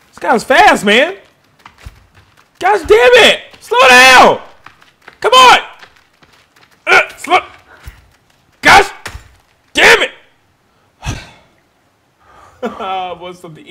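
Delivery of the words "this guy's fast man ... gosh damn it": high, loud and fed up; exasperated shouting. Real, not acted. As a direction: yell in frustration as it gets away. Failing to catch fast rabbit.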